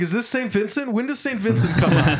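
high-pitched mock-clueless questioning; playing confused. Retelling friend's mock-confused prank.

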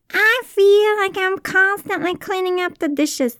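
high, loud mock complaint; odd put-on voice. Shown as a demonstration. Repeating the example in a silly high voice. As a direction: whine it, clearly put on. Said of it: "I don't know why I said it with that voice".

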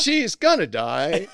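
slow, loud melodrama with misplaced stresses; hammy, terrible line reading; Bad Actor. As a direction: botch "She's gonna die" with drawn-out, overdone emphasis. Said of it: "you'd hit the wrong syllable every time".

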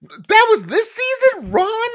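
high, slow, loud squeal of disbelief; shocked disbelief voice. Shown as a demonstration. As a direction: drag it out high, stunned. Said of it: "do 'em really quick".

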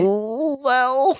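high, swooping mock "ooh, well". Imagined reaction to someone.

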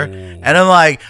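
ordinary talk, nothing marked

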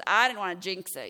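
loud mock-defensive excuse; imitated defensive husband. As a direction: protest loudly as the husband. Acted defensive husband's reply.

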